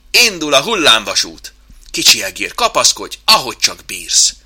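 loud, rousing ride call; excited announcement. Acted. Chimp warns mouse to hold on, excited shout. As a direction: call it out like a fairground operator.

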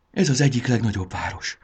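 usual speaking voice

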